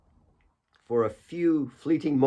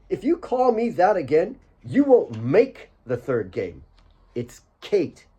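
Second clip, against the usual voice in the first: playful threat, slowed down; a teasing, put-on sternness; mock stern warning; 6 semitones higher than usual. Kate mock-warning Asif not to call her that.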